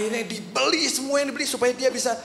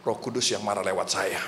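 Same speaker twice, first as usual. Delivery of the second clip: mock pious excuse; 13 semitones lower, 3 dB quieter than usual. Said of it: "dia bilang".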